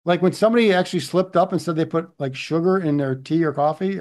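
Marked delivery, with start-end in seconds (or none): none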